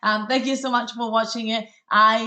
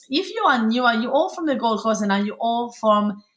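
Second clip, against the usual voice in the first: usual speaking voice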